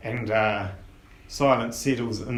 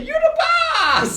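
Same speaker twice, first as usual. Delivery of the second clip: very high, loud, drawn-out goblin cry; goblin shout; 6 dB louder, slower than usual. Acted. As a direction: shout it in a squeaky goblin falsetto. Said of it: "And they say".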